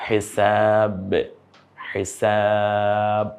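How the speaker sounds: হিসাব drawn out slowly, each sound clear; slow careful pronunciation. Shown as a demonstration. Quran teacher modelling হিসাব slowly for students. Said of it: "এভাবে বললে হয় না".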